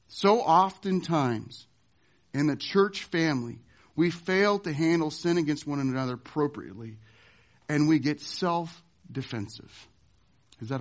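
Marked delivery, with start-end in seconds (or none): none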